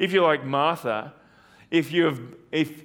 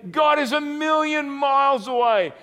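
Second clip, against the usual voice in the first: voice of doubting feelings; 8 semitones higher than usual. Acted. Preacher voices his doubting feelings, higher and plaintive. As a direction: cry out the feeling of abandonment. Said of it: "My feelings might say".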